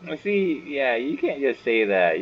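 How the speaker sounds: usual speaking voice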